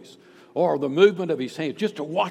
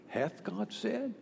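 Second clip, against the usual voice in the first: slow, soft, higher-pitched tempter's question; insinuating doubt; 6 semitones higher, 10 dB quieter, slower than usual. Acted. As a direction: plant doubt with a hushed, drawn-out question. Satan tempting Eve with doubt.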